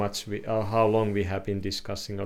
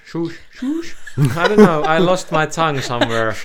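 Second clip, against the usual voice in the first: higher and louder through laughter; laughing; 5 semitones higher, 8 dB louder than usual. Real, not acted. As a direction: laugh while fumbling for the word. Laughing over a lost word.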